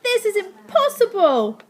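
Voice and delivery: put-on high, loud, despairing boy; wail. A narrator's reading. Boy to Slime after a failed landing, wailing loudly. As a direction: wail it in exasperation. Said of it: "the boy wailed".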